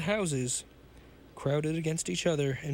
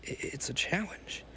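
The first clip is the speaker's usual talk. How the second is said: hesitant, slightly softened voice; said softly; 3 semitones higher, 4 dB quieter than usual. A narrator's reading. Young man softly answering the challenge, said softly. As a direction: stammer it softly, a touch breathless. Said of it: "he said softly".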